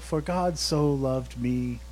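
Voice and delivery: slow, soft scripture reading; hushed, slow verse reading; reverent reading. Acted. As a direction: savor each word of the verse.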